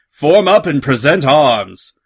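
loud, slow parade-ground order; captain's commanding shout; barked command. A narrator's reading. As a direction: bark it out to the troops, stretching the words. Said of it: "he barked".

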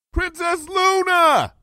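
high, drawn-out shout; calling out. A narrator's reading. Pupil calls down from the tower to the princess, long calling shout. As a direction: call across a long distance. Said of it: "I called out".

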